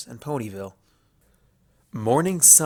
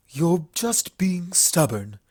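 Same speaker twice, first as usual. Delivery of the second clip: higher, boastful royal voice; haughty princess; 5 semitones higher, slower than usual. Acted. Luna boasting to Applejack she can handle anything, haughty. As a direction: brag about her powers.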